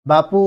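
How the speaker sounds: বাপু called loud with a long উ; বাপু stretched like a call. Shown as a demonstration. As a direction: hold the উ of বাপু. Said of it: "উ ধ্বনিটাকে আমরা বারবার টেনে লম্বা করছি".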